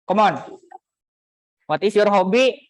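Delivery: ordinary talk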